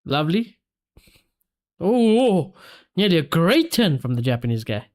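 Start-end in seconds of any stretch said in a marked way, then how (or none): none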